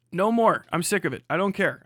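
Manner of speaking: ordinary talk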